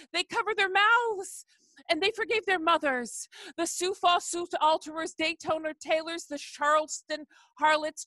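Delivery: rhythmic, rolling list-poem reading; poetry performance. Acted. Poet performing his Hallelujah poem for the audience, rolling litany. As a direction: let the names roll like a litany.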